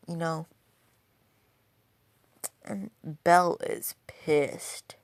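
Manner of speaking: usual speaking voice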